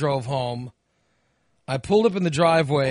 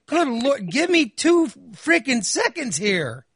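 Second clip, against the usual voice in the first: exasperated, much higher, overdone; mock exasperation at being rushed; 8 semitones higher than usual. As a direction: throw your hands up, give me a second. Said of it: "I'm like".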